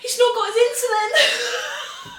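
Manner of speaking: high, slow, half-laughing sob; mock sobbing. Retelling crying over the kidnapped son's insulin, mock sob. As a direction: wail it through laughing tears. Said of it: "hyperventilating and your throat goes dry".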